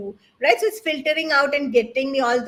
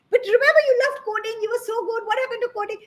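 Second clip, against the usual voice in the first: mother's pleading voice; 9 semitones higher, faster than usual. Retold or imitated. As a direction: coax your kid like a mom. Mother pleading with son in story.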